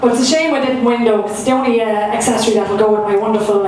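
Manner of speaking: normal talking voice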